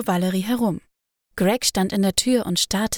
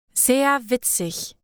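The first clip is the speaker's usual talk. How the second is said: slow, higher, dry sarcasm; sarcastic retort; 4 semitones higher, much slower than usual. A narrator's reading. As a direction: drag it out mockingly. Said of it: "konterte Valerie". (langsam und spöttisch gekontert)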